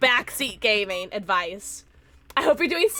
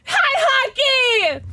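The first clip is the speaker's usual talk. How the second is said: elated greeting; 11 semitones higher, slower than usual. Real, not acted. Streamer greeting a viewer right after winning, high elated cry. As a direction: shout hello overflowing with joy.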